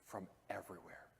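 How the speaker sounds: trailing into a whisper. Real, not acted. Preacher closes the scripture reading in a soft whisper. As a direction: let the last words fall to a whisper.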